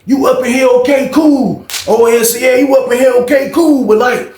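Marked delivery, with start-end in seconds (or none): 0.1-1.7 high-pitched, aggrieved retold protest; arguing back. 2.4-4.3 heated high-pitched mimicked retort; arguing back.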